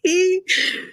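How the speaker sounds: high-pitched mock cackle; imitated laughter. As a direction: mimic her helpless high laughing. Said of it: "She was like".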